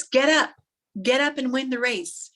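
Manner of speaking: acted shout of encouragement; urging dad. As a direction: call out to a fallen runner. Dad urges fallen son on.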